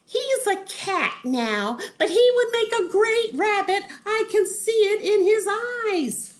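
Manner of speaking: gushing squeaky plea; Wanda. Acted. Wanda pitches her crush to Wizard, very high. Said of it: "Wanda said".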